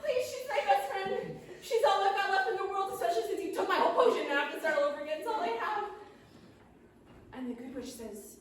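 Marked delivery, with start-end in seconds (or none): 0.0-5.8 high-pitched, hammed-up begging; desperate pleading. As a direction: plead for your only friend, haltingly at first. Gnome pleads for his chicken friend.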